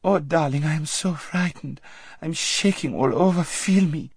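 put-on high, trembling girlish voice; whimpering fright. A narrator's reading. Lazareline clinging to her friend, frightened whimper. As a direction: whimper like a pampered girl in terror. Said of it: "whimpered Lazareline".

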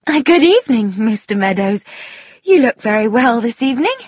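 high, playful greeting with a lilt. A narrator's reading. Tuppence greeting Mister Meadows at dinner, playful. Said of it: "immediately took on a playful manner".

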